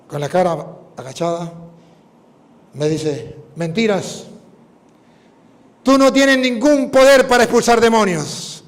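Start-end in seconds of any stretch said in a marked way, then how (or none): 3.6-8.6 loud, high, strange demonic taunt. Retold or imitated. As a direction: defy the priest as the possessing demon. El demonio desafía al padre. (voz de demonio desafiante, fuerte y aguda)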